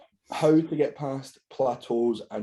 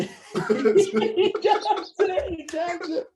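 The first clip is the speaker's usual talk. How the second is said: high squealing laugh through the words; cracking up while saying it; much slower than usual. Acted. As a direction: giggle it out, high. Acting out a fight, laughing.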